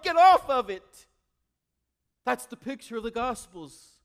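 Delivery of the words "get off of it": high, loud desperate yell, strained; Jesus screaming. Retold or imitated. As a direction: scream it after the passing train. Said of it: "flashing and screaming and waving his arms".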